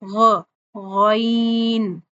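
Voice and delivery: ग said heavy, then गैन with the ऐन part thin; slow teaching demo of गैन; correct ghain demo. Shown as a demonstration. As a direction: stretch गैन slowly, heavy start and light vowel. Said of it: "ग जो है वो हमने मोटा पढ़ना है और आगे जो ऐन आना है वो बारीक".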